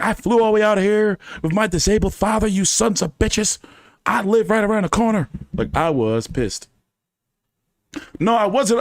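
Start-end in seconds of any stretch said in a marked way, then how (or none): 0.0-5.3 high, indignant complaint; retold angry protest; indignant rant. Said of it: "I was like".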